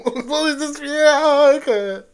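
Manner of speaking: drawn-out, high whine through laughter; long exasperated laughing moan; helpless laughing wail. Real, not acted. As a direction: whine it slowly, half laughing, half crying. Helpless reaction to absurd film.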